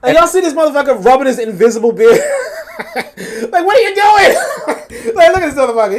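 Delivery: loud, high, cracking up; incredulous, loud laughter-filled outburst; real laughing disbelief. Real, not acted. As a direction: laugh helplessly through the words. Cracks up watching the man's gesture.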